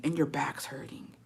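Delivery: breathy whisper of a sore back. Acted. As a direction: whisper it, drained. Whispered pain aside.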